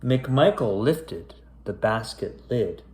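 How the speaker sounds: spooky rhyming storybook read; story narration. A narrator's reading. Back to reading the creepy rhyme to kids, storyteller voice. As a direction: pick the tale back up, storyteller voice.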